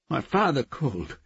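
high, loud agitated voice; agitated outburst. A narrator's reading. Woman explaining her father's call, agitated. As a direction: raise pitch and volume in alarm.

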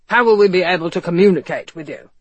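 forceful character voice, insistent; demanding commissioner. A narrator's reading. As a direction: press him as a police chief would. Commissioner questions Ethan in character voice.

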